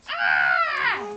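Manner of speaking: loud, high acted shriek; scream. A narrator's reading. Francine meeting Wilson's scream, screaming. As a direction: scream back in fright. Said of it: "screamed Francine".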